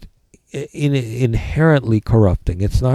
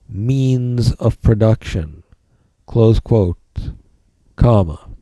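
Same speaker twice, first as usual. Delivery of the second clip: dictating; slower than usual. Shown as a demonstration. Dictating notes to the class.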